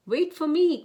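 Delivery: high, loud little call. A narrator's reading. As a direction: cry after the others not to be left behind. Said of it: "cried Mouse".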